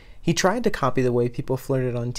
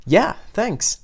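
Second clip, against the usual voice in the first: higher and louder, a cheerful thanks; bright exclamation; 7 semitones higher, 5 dB louder than usual. Acted. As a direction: toss it off happily. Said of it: "Reki exclaimed".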